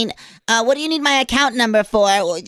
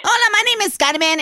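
higher, bright Hola greeting; caller character voice; 7 semitones higher than usual. Acted. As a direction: introduce yourself as a lively caller. Caller introduces herself again.

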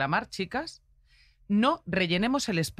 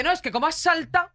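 higher, louder mock rant of an irate driver; retold driver's raised-voice rant; angry driver; 10 semitones higher, 5 dB louder, faster than usual. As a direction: play the indignant driver shouting out his window.

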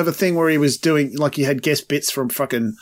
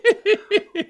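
a sudden high laugh of disbelief; high burst of laughter. Real, not acted. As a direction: laugh out, caught off guard. Laughing at a song lyric.

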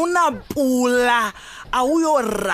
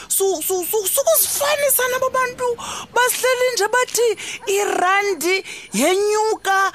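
shouting match; 8 semitones higher than usual. Acted. Heated quarrel about money.

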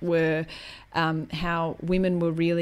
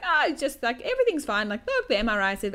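higher-pitched, chipper brush-off; really chipper; 6 semitones higher, faster than usual. Retold or imitated. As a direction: play it breezily upbeat, storming on. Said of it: "like, this really chipper".

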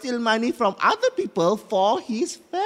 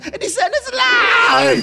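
high, loud, slow drawn-out cry; ranting shout; 8 semitones higher, 7 dB louder, much slower than usual. Acted. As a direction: build to a furious yell. Toyol rant peaks.

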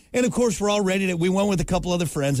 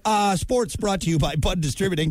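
ordinary talk, nothing marked

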